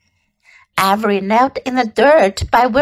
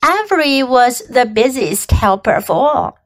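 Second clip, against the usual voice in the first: plain reading voice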